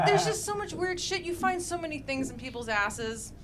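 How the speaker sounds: ordinary talk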